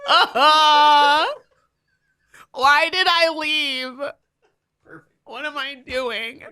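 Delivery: high, hammed-up fake crying; mock sobbing. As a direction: pretend to bawl with smeared mascara.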